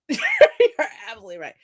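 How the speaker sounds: real laugh bursting into the words; amused, chuckled-out agreement. Real, not acted. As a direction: laugh while saying it. Laughs while agreeing with the remark.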